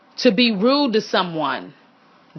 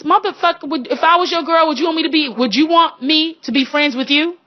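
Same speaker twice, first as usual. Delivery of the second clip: high, heated challenge; raised-pitch mock outrage at a pushy suitor; indignant retort; 6 semitones higher than usual. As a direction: throw the question back at him, exasperated. Acted retort to pushy man.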